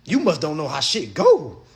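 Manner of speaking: ordinary delivery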